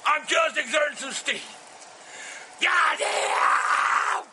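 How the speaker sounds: ranting curses; ranting at YouTube, steam let off; angry rant. Real, not acted. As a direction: vent your fury at them. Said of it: "I'm goddamn mad".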